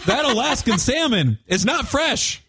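high-pitched, drawn-out punchline; emphatic punchline. Real, not acted. Radio host warning diners about Alaskan salmon, emphatic.

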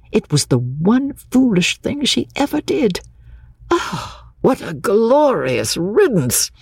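high, elated cry of relief; exclaiming to himself. A narrator's reading. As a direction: exclaim privately with rising delight. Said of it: "exclaimed Mister Beebe to himself".

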